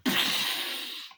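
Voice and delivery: long noisy mouth-made blast; imitated whoosh of flames. Teller showing the goose going on fire, imitated blast. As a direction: make the fire erupt with a rush of breath.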